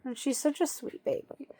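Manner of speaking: soft loving whisper. Real, not acted. Owner whispering praise while petting. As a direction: whisper it to the dog.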